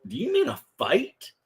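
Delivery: incredulous question. Retold or imitated. Baffled Kentuckian asking Michiganders about a wally, high incredulous question. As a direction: ask it like the word makes no sense. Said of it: "I would just look at 'em like".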